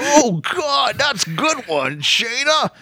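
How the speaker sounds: high, drawn-out mock praise; mock amused reaction. Podcaster play-acts reacting to Shayna's line, high put-on voice. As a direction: gush in a put-on high voice, slowly.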